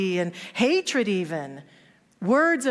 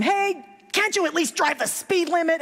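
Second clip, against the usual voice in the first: yelling; 9 semitones higher than usual. Retold or imitated. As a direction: shout at him through the window. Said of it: "yelling at him".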